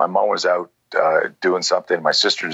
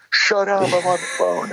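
annoyed snap; 13 semitones higher than usual. Retold or imitated. Brushing off sister, retold.

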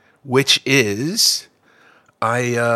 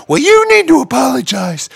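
high, loud, frantic woman's voice; upset crazy lady; 10 semitones higher, 5 dB louder than usual. Retold or imitated. Upset fan confronting the comedian after the show, loud and frantic. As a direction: shriek it like an upset stranger. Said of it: "this crazy, crying lady".

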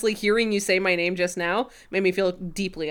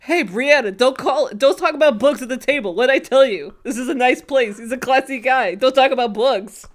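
scolding mobster impression, higher and louder; [name]'s crime voice; 5 semitones higher, 5 dB louder than usual. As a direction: play a tough crook telling off his girlfriend. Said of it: "[name] keeps doing his crime voice".

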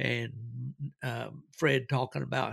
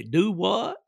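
Southern do what; 6 semitones higher than usual. Retold or imitated. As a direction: bark a puzzled Southern do what. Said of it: "he'd say".

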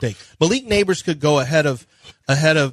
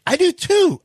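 jumps way higher and louder, thrilled; excited agreement; 5 dB louder than usual. Retold or imitated. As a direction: blurt it out like you found an ally.

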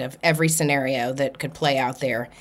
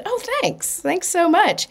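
bright surprised thanks; 14 semitones higher than usual. Retold or imitated. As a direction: jump way up high on "Oh, thanks". Reacting to a compliment on shoes.

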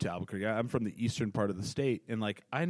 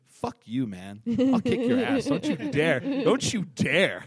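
higher, louder tough-guy threat; mock Albuquerque thug; 8 semitones higher, 4 dB louder, slower than usual. Comic imitating a local threatening an outsider, higher and louder. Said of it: "They're like".

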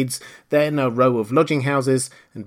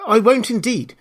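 high, louder, flustered Alice; hurried promise; 10 semitones higher, 6 dB louder than usual. A narrator's reading. Alice hurries to change the subject, flustered. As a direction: promise anxiously, eager to move on. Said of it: "in a great hurry to change the subject".

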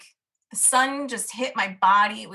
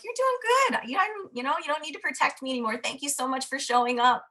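much higher, cooing and tender; sweet talk to body; 4 semitones higher than usual. Pretend. Thanking her own belly fat, she coos higher and tenderly. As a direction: praise it like a beloved pet.

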